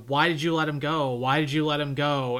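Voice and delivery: loud pleading cry; hysterical wife. Retold or imitated. Wife begging the doctors over her husband, loudly. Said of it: "she is just sort of hysterical".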